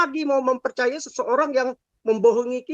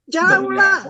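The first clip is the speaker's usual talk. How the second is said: loud dismissal; 7 semitones higher, 6 dB louder than usual. Real, not acted. Dismissive outburst in heated talk.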